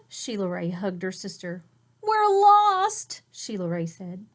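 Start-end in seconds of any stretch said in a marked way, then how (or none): 2.0-3.2 frightened wail. Acted. Frightened girl admits.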